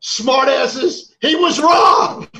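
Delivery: loud, high, drawn-out yell; defiant shout. Real, not acted. Quiz host insisting on a ruling, defiant shout. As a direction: shout down the objectors, stretching it out.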